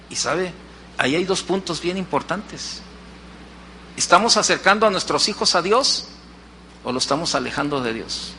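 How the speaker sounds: ordinary talk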